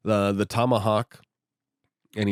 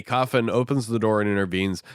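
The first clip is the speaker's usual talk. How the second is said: normal talking voice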